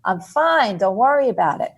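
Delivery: mild mock yell down to her daughter; mom yelling down. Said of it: "And she yells down".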